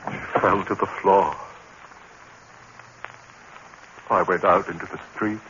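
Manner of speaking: low, somber retelling; dazed confession narration; hushed narration. A narrator's reading. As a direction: recount it numbly.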